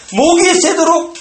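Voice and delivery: straining shout. Shown as a demonstration. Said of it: "describes crying out".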